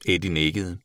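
usual speaking voice

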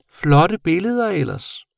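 high, slow creature voice; admiring bat. A narrator's reading. Bat admiring the boy's drawings, high and drawn out. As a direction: draw out the praise, high-pitched.

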